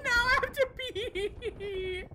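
laughing through tears; laughing crying. Real, not acted. Laughing while panicking.

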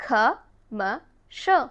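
Commercial stretched very slowly, ending "shul"; slow teacher demo; slow drawn-out model. Shown as a demonstration. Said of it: "but it's actually".

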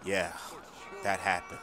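ordinary talk, nothing marked

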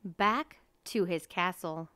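usual speaking voice

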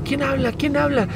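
high puzzled phone voice; mother answering an unknown call, high and puzzled; imitating mom on phone. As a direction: mimic a confused mother answering. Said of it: "me decía así como".

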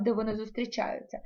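normal talking voice

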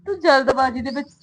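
ordinary talk, nothing marked

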